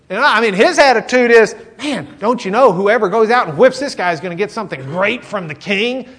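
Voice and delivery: high, loud and eager, keen to fight; a boy bursting to volunteer; eager excitement. Retold or imitated. Young David asking eagerly who gets to fight. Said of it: "David's response is".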